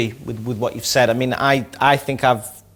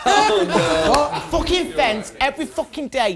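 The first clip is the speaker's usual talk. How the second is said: rowdy jeering pub banter; 11 semitones higher than usual. Real, not acted. Drunken rant in clip.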